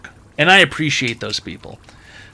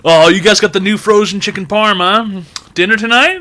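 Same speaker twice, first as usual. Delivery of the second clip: chatty cashier; 6 semitones higher, 6 dB louder than usual. Retold or imitated. Mimicking cashier, chicken parm.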